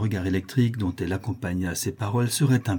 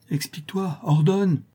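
high, strained begging; urgent pleading; 8 semitones higher than usual. A narrator's reading. Notary begging Cécilie to command him, urgent. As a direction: beg for orders, pitch jumping up. Suitor begs for orders.

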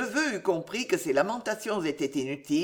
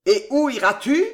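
put-on loud, higher old man's demand; 5 semitones higher, 8 dB louder than usual. A narrator's reading. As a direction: bark the question. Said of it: "demanda le vieillard".

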